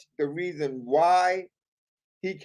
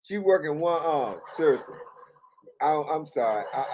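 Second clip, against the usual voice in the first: usual speaking voice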